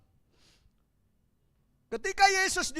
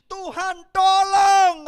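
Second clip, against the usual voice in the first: loud, rising shout of Tuhan, tolong; Peter's cry; 9 semitones higher, 15 dB louder, much slower than usual. Acted. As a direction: cry out to God in desperation. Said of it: "kata Petrus".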